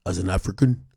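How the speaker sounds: half-hearted African accent impression; Dikembe Mutombo voice. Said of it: "now you gonna have me read this in a Dikembe Mutombo voice".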